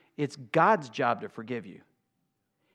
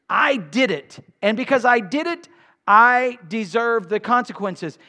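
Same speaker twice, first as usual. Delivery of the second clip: louder, higher preaching that keeps climbing; full-force preaching; 7 semitones higher, 7 dB louder than usual. Acted. Preacher rousing his congregation, loud full-force preaching. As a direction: drive it home like a revival preacher.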